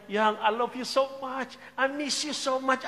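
high, slow, hammed-up sweet talk; sweet-talking girlfriend. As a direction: coo it to your boyfriend. Acted sweet talk to boyfriend.

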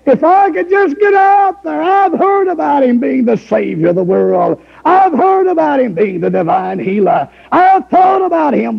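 high pleading preacher's voice; preaching at full force. Acted.

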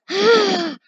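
high loud exasperated sigh. Real, not acted. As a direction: let out a big sigh.

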